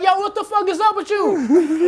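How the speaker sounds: high-pitched, laughing indignation; indignant protest. Acted. Son confronting mom over favoritism, mock indignant. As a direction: push it way up high, half laughing.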